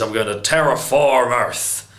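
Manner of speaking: a hint of a menacing Zod, close to own voice; bad Zod impression. Reviewer imitating Zod revealing his plan, weakly voiced villain. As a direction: try a flat villain line as Zod, barely shifted. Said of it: "That was terrible".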